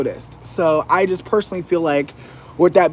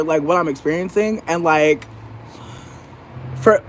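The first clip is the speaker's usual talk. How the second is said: normal talking voice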